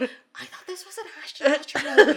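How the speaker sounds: high, loud mock dismay; distraught moviegoer. Shocked audience expecting an Ashton Kutcher comedy, mock distressed. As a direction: imitate an upset fan losing it. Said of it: "People are losing their minds".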